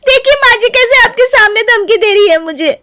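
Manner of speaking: high, loud tattling; shrill complaint. Acted. Neeru complains to mother-in-law, high and loud.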